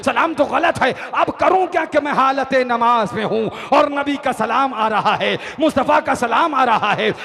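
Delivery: loud, high-energy sermon delivery; impassioned rhythmic declamation; full-force preaching. A narrator's reading. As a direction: hammer each phrase out to a large crowd.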